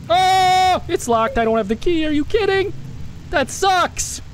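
high-pitched exasperated outburst; frustrated protest, voice jumping high. Real, not acted. Finds the door locked, no key.

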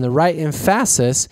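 em-FASS-is, stressed on the middle; deliberately misstress the word emphasis; second-syllable stress on emphasis; misplaced stress on emphasis. Retold or imitated. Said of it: "was Mike Myers quote".

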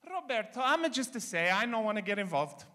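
put-on high, Italian-accented mom voice; Calabrese mother. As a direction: play the meddling Italian mother, innocent. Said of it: "she always has the same answer".

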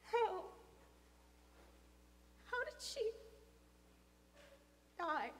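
high, broken-up question; shocked faltering. Acted. Boy asking father about death, shocked and faltering. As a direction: struggle to get the question out.

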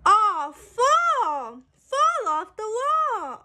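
bright, sing-song picture-book reading; reading to children. A narrator's reading. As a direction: read it out like a storybook for little kids. Book text read aloud.

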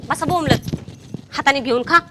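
usual speaking voice

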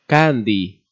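plain reading voice

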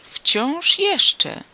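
high, loud insistent cry; emphatic exclamation. A narrator's reading. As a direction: hammer it home at the top of the voice. Neighbour repeats phrase more forcefully. (dobitny, wysoki okrzyk)

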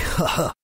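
short sardonic laugh; evil chuckle. Acted. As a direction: gloat with a cruel little laugh. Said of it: "L'homme partit d'un rire sardonique". (rire sardonique)

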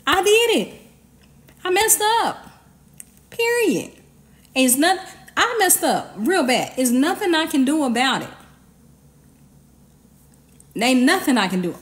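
high, pleading admission of guilt; guilty confession. Shown as a demonstration. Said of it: "Yeah, say".

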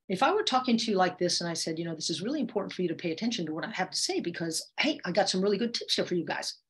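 rushed fast delivery; hurried speech with no pauses; too fast. Shown as a demonstration. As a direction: rattle it off too quickly. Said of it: "talking way too fast".

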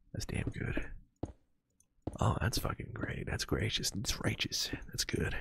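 soft breathy whisper of delight; whispered praise. Real, not acted. Streamer whispering praise to himself over his build, in a soft whisper. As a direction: whisper it like savoring a treat.

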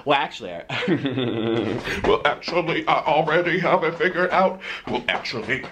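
giggling. Real, not acted. Clip, giggling to himself.

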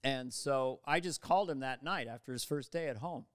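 normal talking voice

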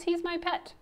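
high-pitched, earnest little explanation; lightly voiced boy. A narrator's reading. Louis tells the coach about Alphonse, high and earnest.

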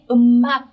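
"음악" run together as eu-mak, the M starting the second syllable; nối âm. Shown as a demonstration. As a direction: say "음악" linked, no break before the second vowel. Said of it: "các bạn phải nối lên". (đọc "음악" nối âm thành "ư-mác")

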